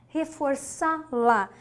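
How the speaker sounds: "reforçá-la" slowed, each syllable set apart; measured syllables. Shown as a demonstration. Teacher pointing out the accented word, "reforçá-la" in measured syllables. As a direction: say "reforçá-la" deliberately, syllables lightly separated. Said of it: "Olha só".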